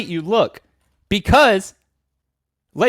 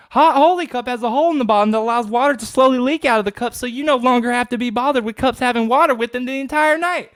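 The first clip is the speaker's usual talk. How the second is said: higher, upbeat pitchman voice; infomercial read; 5 semitones higher than usual. Acted. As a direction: sell it like a late-night infomercial.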